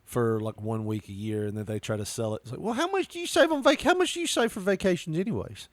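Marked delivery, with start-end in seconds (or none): 2.6-5.7 high, sing-song salesman voice, pitched way up; mock salesman. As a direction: ham up a pushy timeshare seller. Mocking timeshare salesman.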